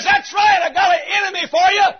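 very high, quick shout; acted high-pitched taunt; deep shouting across. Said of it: "Deep shouts to shallow".

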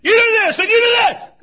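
high, full-force barked command; mock tyrant shout. Preacher mimicking what God doesn't say, shouting. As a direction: bellow orders like a bullying god. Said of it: "God doesn't say to some little old person".

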